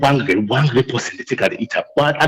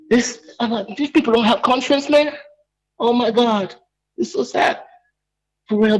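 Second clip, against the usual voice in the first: upset; 7 semitones higher than usual. Real, not acted. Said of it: "I, I'm pissed".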